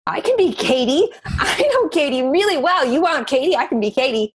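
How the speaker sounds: rising, laughing delight; laughing, giddy self-belief; excited, laughing realization. Retold or imitated. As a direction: burst out with joy at being herself. Her joyful realization.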